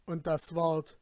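plain reading voice

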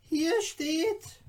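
high, eager little dwarf guide; courteous little dwarf. A narrator's reading. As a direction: play the tiny helpful dwarf showing off treasures. Dwarf guide shows the cabinet.

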